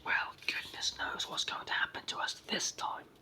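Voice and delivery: ordinary delivery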